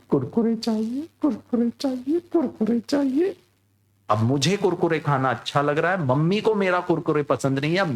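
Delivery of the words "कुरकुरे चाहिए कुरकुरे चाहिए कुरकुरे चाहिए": put-on high whining little boy, rising each time; child pestering mom for snacks; child whine. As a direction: nag like a kid who won't stop. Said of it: "अब मैं mummy को कह रहा हूं".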